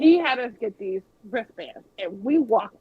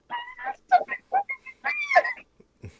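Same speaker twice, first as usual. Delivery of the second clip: very high, slow, half-crying squeal; starstruck wail; much slower than usual. Retold or imitated. After being told to chill, she squeals at Stephanie McMahon even harder, very high and slow. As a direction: stretch it out like you're about to cry from excitement.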